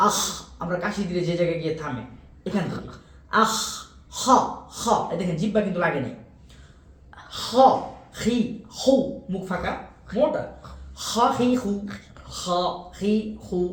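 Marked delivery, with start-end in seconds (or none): 3.4-13.6 খ and হ from the back of the throat; throat letter demo. Shown as a demonstration. Teacher showing students the throat sound খ. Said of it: "খ উচ্চারণ হবে এই যে হলকের শেষ ভাগ থেকে".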